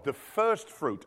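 slow, weighty repeat of first fruit; slowed emphatic repeat. Shown as a demonstration. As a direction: drive it home slowly. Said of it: "slowed repeat".

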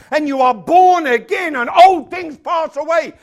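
loud, high, declamatory preaching; full-force preaching. Real, not acted. Preacher telling the congregation they are born again, loud preaching.

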